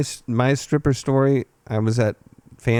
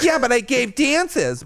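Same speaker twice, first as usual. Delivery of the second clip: whiny stripper; 11 semitones higher, slower than usual. Retold or imitated.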